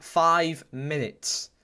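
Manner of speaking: slowly spelled out, x0.4; slow emphatic. Real, not acted. Streamer stressing added time, slow. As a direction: stretch each word for the viewers. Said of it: "I repeat that".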